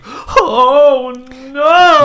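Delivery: high, loud hammed-up wail; mock panic. Imitating the scared game heroine, mock panic. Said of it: "then she's like".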